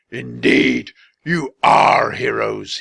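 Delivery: the wizard gasping out praise; breathless wizard. A narrator's reading. Wizard to the children, slow and breathless. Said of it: "he said breathlessly".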